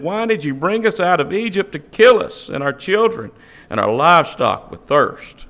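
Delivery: ordinary talk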